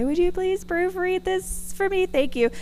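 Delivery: high, slow, sugary ask; sweetly asking a favor; sweet request to mom. Retold or imitated. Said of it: "and I say".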